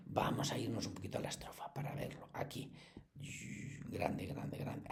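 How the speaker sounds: whispered aside to herself. Real, not acted. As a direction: murmur it under your breath, unvoiced. Aside while moving to the page. (susurrando para sí)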